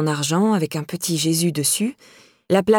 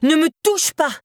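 cried out; 9 semitones higher than usual. A narrator's reading. Laura pushed off balance by Myriam, crying out. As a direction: shriek it as she shoves you. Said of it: "s'écria Laura".

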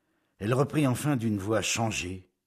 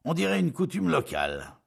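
high, louder amused remark; smiling; 10 semitones higher, 5 dB louder than usual. A narrator's reading. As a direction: say it with a smile in the voice.

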